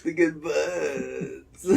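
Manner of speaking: very slow, soft, drawn-out echo; teasing mimicry. Echoing friend's wide-eyed praise, slow teasing mimicry. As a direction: draw it out in mock awe. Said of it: "I saw you say".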